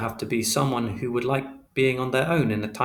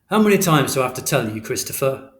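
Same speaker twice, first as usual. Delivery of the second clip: louder, fast exasperated scolding; exasperated outburst; 2 semitones higher, 6 dB louder than usual. Acted. Father losing patience with Christopher, exasperated and loud. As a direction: rush it out, fed up.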